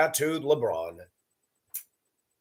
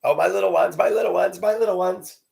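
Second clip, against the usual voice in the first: higher, rising mock coo; doting grandmother; 7 semitones higher than usual. Grandmother gushing over grandchildren, mocked higher. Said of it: "with food all over their face".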